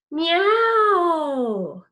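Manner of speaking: high, loud drawn-out meow; long loud cat meow. Shown as a demonstration. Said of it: "Make that sound".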